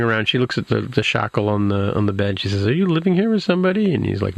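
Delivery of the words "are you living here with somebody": high-pitched, quick imitation of her question; mock incredulous question. Wife quizzing husband about the bed shackle, high mock incredulity.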